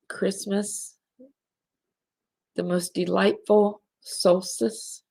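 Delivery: slow holiday blessing. Real, not acted. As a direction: bless the listeners slowly, letting each phrase land.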